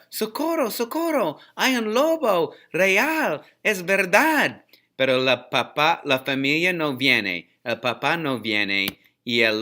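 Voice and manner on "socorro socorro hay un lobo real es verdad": put-on high, urgent boy's cry for help. A narrator's reading. Shepherd boy begging his family for help, high frightened cry.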